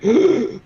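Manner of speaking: sharp mock gasp of a huff; imitated gasp. Retelling buddy huffing nitrous, imitated gasp. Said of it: "and then he's like".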